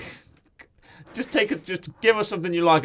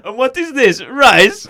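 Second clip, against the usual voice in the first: high, loud naive question; Borat; 6 semitones higher, 9 dB louder, slower than usual. Acted. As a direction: ask it in a put-on foreign accent. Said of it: "And Borat goes".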